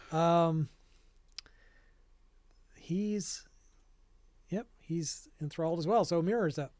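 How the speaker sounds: normal talking voice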